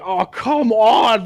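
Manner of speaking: drawn-out, slow exasperated groan; slow resigned complaint before exploding; mock henchman groan. Henchmen before exploding, slow exasperated groan. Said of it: "they're like".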